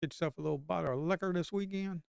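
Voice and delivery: ordinary talk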